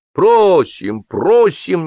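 guests urging. A narrator's reading. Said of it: "не отставали гости".

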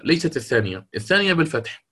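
ordinary talk, nothing marked

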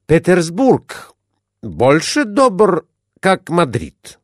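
put-on Spanish accent. A narrator's reading. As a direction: ask permission in a halting foreign voice. Captain asks solemnly.

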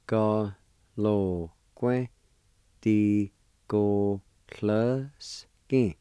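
very slow Di go hluskin, each syllable held; drawn out. Shown as a demonstration. Teacher slowly joining the parts of the word. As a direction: stretch Di go hluskin out.

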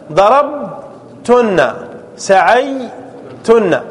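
ضربتم pulled out slowly to the final م; slow drawn-out demo. Shown as a demonstration. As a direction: stretch the ending for the class. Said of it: "teacher drawing out verb forms". (نطق ضربتم ببطء شديد)

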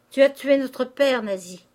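high, loud reproach; accusing cry. Acted. Sister accusing sister as their father lies fainted, accusing. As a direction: hurl the accusation at her.

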